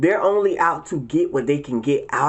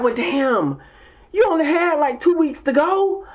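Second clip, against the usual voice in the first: exasperated; 10 semitones higher than usual. Retold or imitated. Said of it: "And you be like".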